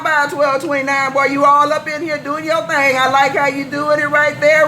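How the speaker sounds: ordinary talk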